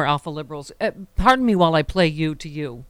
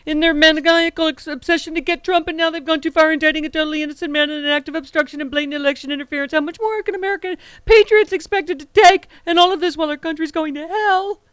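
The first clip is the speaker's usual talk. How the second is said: reading Trump's post aloud in a mocking, pitched-up voice; mocking Trump rant; 8 semitones higher than usual. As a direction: ham up an outraged Trump screed, pitched well up. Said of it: "announcement from the bassinet of the forty-fifth president".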